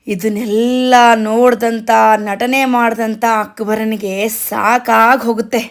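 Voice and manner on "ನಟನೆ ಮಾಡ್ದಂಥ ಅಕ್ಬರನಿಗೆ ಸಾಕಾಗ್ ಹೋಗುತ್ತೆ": ordinary delivery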